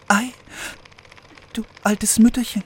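put-on high, curious young princess voice; sweet fairy tale princess. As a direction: ask sweetly like an innocent princess. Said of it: "sprach die Königstochter".

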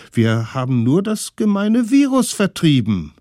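hoch und laut protestierend; trotzige Kinder. Acted. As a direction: wie Kinder, die sich im Recht fühlen. Kinder verteidigen sich. (trotzige Kinderstimmen, hoch und laut)

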